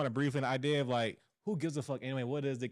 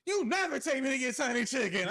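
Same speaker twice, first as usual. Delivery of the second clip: put-on high-pitched little-girl protest; daughter's complaint; 10 semitones higher than usual. Dad imitating his daughter demanding chicken, high mock child protest. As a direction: mimic an indignant kid, voice way up. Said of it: "Queen, uh, she loved Chick-fil-A".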